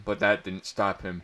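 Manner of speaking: ordinary delivery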